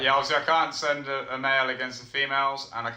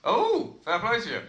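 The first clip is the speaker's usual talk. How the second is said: surprised; 9 semitones higher, 3 dB louder than usual. Real, not acted. Impressed by opponent's pick.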